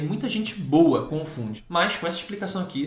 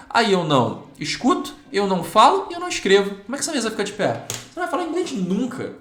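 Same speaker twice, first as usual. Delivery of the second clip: rising alarm; 7 semitones higher, 4 dB louder than usual. Real, not acted. Teacher's emphatic warning, rising.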